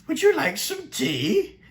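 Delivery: put-on very high, slow, fluttery old professor voice with a laugh; the professor offering tea. Reviewer imitating the Doctor Who professor, high and slow with a laugh. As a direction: play the dotty professor politely offering tea. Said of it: "I absolutely love the professor".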